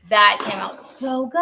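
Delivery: slightly slowed, emphatic delight; excited praise. Real, not acted. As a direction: say it out loud, lifting at the end. Repeats praise in brighter delivery.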